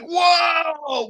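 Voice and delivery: high excited shout; excited whoa. Retold or imitated. Friends at a barbecue hearing booms, high excited shout. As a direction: shout it out in delight. Neighbor's fireworks go off.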